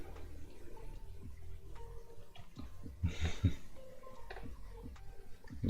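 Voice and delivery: long breathy laugh, quiet and off mic; soft real chuckle trailing on. Real, not acted. Streamer laughing at own plans.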